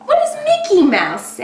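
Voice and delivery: high, bright singsong question. Acted. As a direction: ask it up high for the baby. Asks toddler again.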